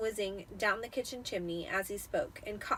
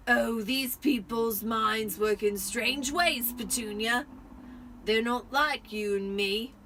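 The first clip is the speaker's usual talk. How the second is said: louder, slow pompous uncle; Uncle Vernon voice; 5 dB louder, slower than usual. A narrator's reading. Vernon explaining to Petunia, slow and self-important. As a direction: lecture it like a smug husband. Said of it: "said Uncle Vernon".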